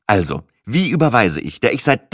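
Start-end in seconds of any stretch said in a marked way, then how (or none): none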